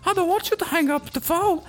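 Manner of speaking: very high squeaky cartoon voice; talking telephone. Telephone refusing to hang up, high and wilful. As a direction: play a defiant little phone in a high-pitched voice. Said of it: "sometimes the telephone's like".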